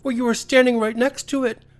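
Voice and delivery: high little-creature voice, mock surprise; small creature Teddy, fussy and polite. Teddy's voice in the story.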